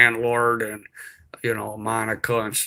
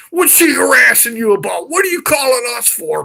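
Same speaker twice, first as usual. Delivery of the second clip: high, loud shouting; yelling cop; 12 semitones higher, 8 dB louder than usual. Retold or imitated. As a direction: bark it like an angry cop. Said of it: "start yelling at me".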